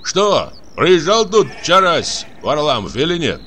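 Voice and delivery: high outdoor call; called-out question. Acted. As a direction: shout a question across to the shepherds. Calling a question to shepherds.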